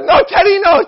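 high, loud panicked begging; terrified pleading. Retold or imitated. Kid begging his brother Teddy not to burn him. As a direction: beg for mercy in a shriek.

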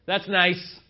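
loud, mock-hearty brush-off; brusque dismissal. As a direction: blurt it loudly, untouched by the sentiment. Brusque reply to "I love you.

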